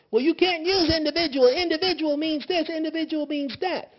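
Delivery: high, loud whiny mock of the nitpickers; mocking objector. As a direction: whine it like a pedantic critic. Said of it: "here we go again with these words".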